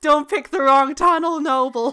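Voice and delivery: loud urgent call; loud warning. Retold or imitated. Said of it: "And you're like".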